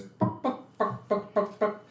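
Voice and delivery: high clucking chicken noises. Retold or imitated. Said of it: "he goes".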